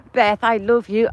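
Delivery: ordinary delivery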